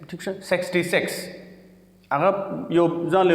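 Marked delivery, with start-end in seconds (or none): 0.5-1.1 سکسٹی سکس as "seksty seks"; "eh" vowel in both words of سکسٹی سکس; mispronounced sixty-six. Shown as a demonstration. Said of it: "ډېر ملګري هغه وایي سیکس".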